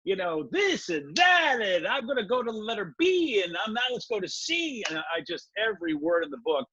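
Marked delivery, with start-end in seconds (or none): 0.5-4.8 mock ranting. Listing insults in frustration.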